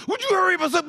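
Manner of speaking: high, pushy whine; impatient boyfriend, pitched high. Retold or imitated. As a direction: hurry her, exasperated. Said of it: "I couldn't go".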